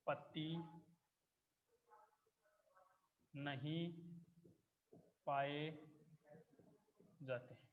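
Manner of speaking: word by word with long pauses; spaced-out dictation to the class; slow dictation. Shown as a demonstration. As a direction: dictate each word slowly for notes. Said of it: "teacher dictating notes".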